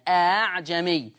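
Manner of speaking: slow أعجمي, second hamza melted into a long vowel; eased second hamza. Shown as a demonstration. Teacher demonstrating Hafs's easing, slow أعجمي with softened second hamza. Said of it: "فحفص سهل الهمزة التانية".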